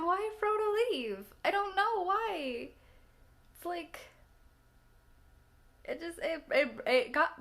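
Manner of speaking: half-crying as she asks why he left; teary and emotional. Real, not acted. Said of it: "I already cried a little bit".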